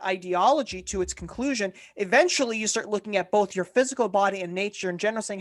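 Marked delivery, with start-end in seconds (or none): none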